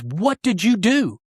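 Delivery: high, slow, emphatic mock demand; imitated amazed wife. Wife asks husband about his changed face, amazed demand, retold. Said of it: "and she goes".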